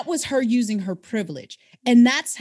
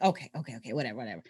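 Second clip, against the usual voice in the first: dismissive mutter; 11 semitones lower, 10 dB quieter, much faster than usual. Retold or imitated. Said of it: "she was like".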